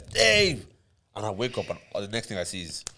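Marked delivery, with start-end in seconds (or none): 0.0-0.6 sharp high call of the name; shouting a name. Retold or imitated. Passenger calling the driver awake, sharp high call. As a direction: jolt the sleeper awake. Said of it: "he says".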